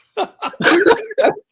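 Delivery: loud high burst of laughter; laughing hard at his own story; big laugh. Real, not acted. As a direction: crack up at the punchline. Laughs at the punchline.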